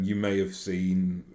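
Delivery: usual speaking voice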